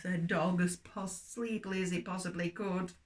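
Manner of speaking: lively storybook reading for little ones; story reading for children. Acted. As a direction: tell it like a bedtime story to children.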